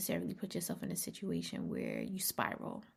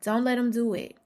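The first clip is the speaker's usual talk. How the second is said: emphatic warning; 4 semitones higher, 8 dB louder than usual. Real, not acted.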